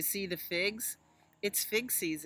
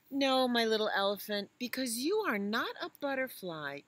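mother elephant voice; 6 semitones higher, 7 dB louder than usual. Acted. Mother answers.

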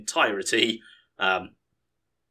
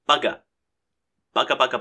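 ordinary talk, nothing marked

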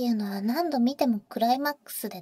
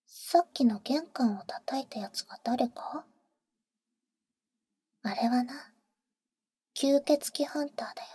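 teasing whisper; 3 dB quieter than usual. Acted. Older sister teasing the boy in his ear, whisper.

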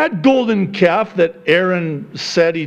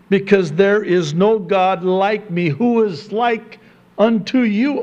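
raised, higher pleading sermon voice; full-force preaching; 7 semitones higher than usual. Acted. Pastor urging the congregation against false gods, full preaching.

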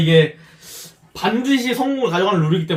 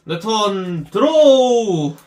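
card duel hero; much slower than usual. Acted. Dramatic card draw.

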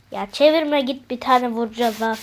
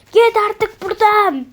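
banishing shout; 9 semitones higher, 8 dB louder than usual. Acted. Banishing the creature.

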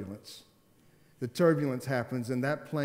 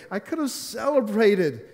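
much higher, louder and slower; preaching climax; 9 semitones higher, 8 dB louder than usual. Acted. Pastor crying out to the congregation, loud preaching. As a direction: belt it out as a sermon peak.

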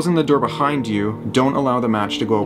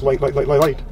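panicked repeating; 5 semitones higher than usual. Real, not acted. Panicking to light the match.

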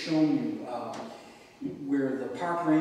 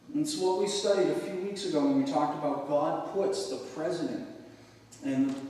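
ordinary talk, nothing marked

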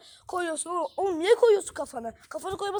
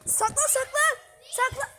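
urgent; 9 semitones higher than usual. Real, not acted. Urging friend to hide.

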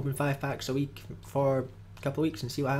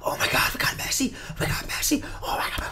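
breathless whispered thrill at a dream pull; mock excited whisper; 12 semitones higher, 4 dB louder than usual. Gamer imagining pulling Messi, whispered mock excitement. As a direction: pretend to gasp over pulling Messi. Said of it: "It'd be".

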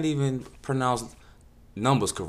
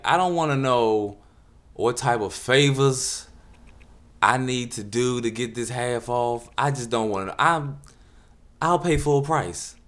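ordinary talk

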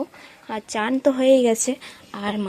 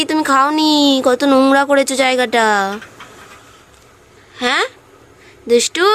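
much higher, louder sing-song coaxing of the pet birds; pet talk; 5 semitones higher, 6 dB louder than usual. Real, not acted.

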